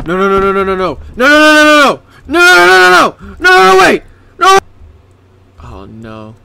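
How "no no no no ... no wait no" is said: rapid high, loud panic; frantic shouted pleading; panicked. Real, not acted. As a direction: yell it in a rising panic. Panics at threat in game.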